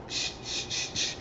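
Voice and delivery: soft repeated "shh" sounds; imitated shushing noise. Imitates the flashlight in the bushes.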